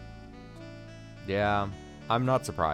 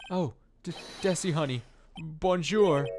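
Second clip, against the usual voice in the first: voiced higher, a sugary Desiree; high-pitched woman voice; 8 semitones higher than usual. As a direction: sing it high and sweet. Voicing Desiree character.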